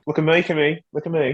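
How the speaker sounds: high-pitched mock Australian catchphrase; put-on Australian accent; Australian impression. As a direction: copy the Aussie catchphrase high up. Copying the Australian catchphrase back.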